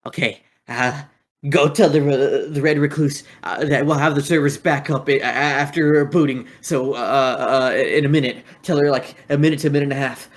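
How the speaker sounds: a hint of a nervous henchman; a goon passing on instructions, lightly voiced. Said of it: "So he goes".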